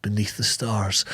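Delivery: low, soft, slowing down; imitated solemn line, softly and low; hushed reverence. Host recites the kneeling hero's line, hushed and reverent. Quotes the film's solemn final moment.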